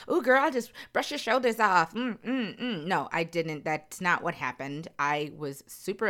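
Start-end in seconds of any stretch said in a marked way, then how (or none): 0.1-2.8 mock breezy advice. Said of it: "I'm not gonna lie to you and tell you".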